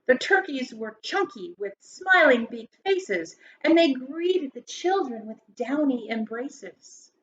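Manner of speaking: lilting rhymed storybook read-aloud; story narration. Acted. Teacher reading a Thanksgiving poem to grade schoolers, lilting. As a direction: read it like a bedtime poem for kids.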